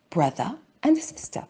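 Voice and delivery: ordinary delivery, nothing marked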